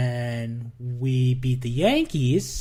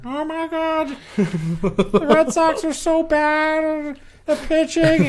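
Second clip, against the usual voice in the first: high, drawn-out puppet frog impression; Kermit the Frog; 14 semitones higher, 4 dB louder, slower than usual. Co-host imitating a Red Sox fan's puppet voice, high frog whine. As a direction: stretch it out in a loud, high-pitched frog voice. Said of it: "like, a Kermit the Frog voice".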